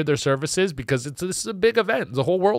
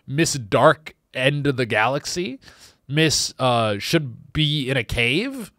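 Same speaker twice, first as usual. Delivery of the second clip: ordinary talk, nothing marked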